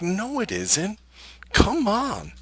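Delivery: incredulous come on; exasperated scoff. Retold or imitated. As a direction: brush off the advice with a scoff. Exasperated reply to teacher.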